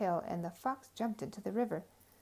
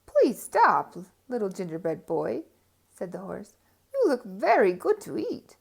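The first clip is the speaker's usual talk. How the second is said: horse voice; 8 dB louder than usual. Acted. Horse speaks.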